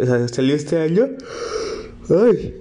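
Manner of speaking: talking through a yawn; words stretched by a yawn. Real, not acted. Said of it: "si estoy bostezando".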